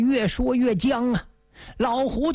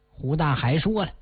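usual speaking voice

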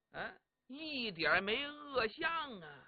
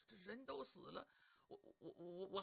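very soft, halting stutter; hushed and flustered, words sticking; reluctant stammer; 17 dB quieter than usual. Acted. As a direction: shrink back, stammering under your breath. Reluctant to view the corpse.

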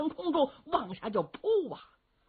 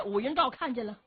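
usual speaking voice

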